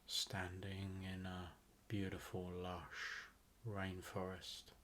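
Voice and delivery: guided meditation. Acted. Host guiding listeners to relax into a rainforest visualization, guided meditation. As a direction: ease them into relaxation, phrase by unhurried phrase.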